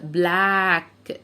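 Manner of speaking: بلاك slowly with a single k; slow demo. Shown as a demonstration. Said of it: "انطقهم كأنهم كه واحدة". (بلاك بكه واحدة ببطء)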